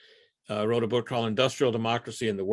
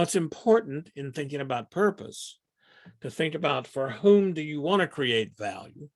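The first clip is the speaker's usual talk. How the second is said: normal talking voice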